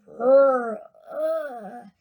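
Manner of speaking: imitated machine rumbling; queer rumblings. A narrator's reading. Storyteller voices the machine's insides, queer rumbling. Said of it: "a few queer rumblings were heard".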